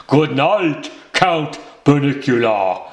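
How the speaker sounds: a hint of a Dracula-style Transylvanian accent; mock Transylvanian accent. Pete teasing the bunny at bedtime, sarcastic mock Transylvanian accent. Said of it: "his attempt at a Transylvanian accent".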